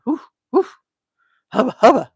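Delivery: loud, high whoops of delight; swooning excitement. Real, not acted. Host swooning over Rip to her chat, loud excited whoops. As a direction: fan yourself and whoop. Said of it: "Okay, calm down, [name]".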